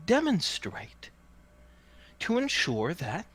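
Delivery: high, theatrical showman's patter; hawker's sales pitch. Acted. Hawker calms doubts after the laughter, high showman's patter. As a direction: win over the crowd with high, swooping, drawn-out emphasis. Game master voices hawker.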